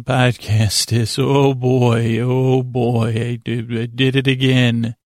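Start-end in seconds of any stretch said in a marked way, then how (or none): none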